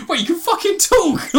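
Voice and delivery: mock astonishment, laughing and high; laughing quote. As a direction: laugh through the shock of it. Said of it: "Captain Boomerang is".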